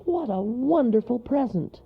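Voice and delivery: high, squeaky joy; thrilled little storybook creature; delighted cry. A narrator's reading. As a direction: exclaim it with wonder. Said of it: "cried Monroe".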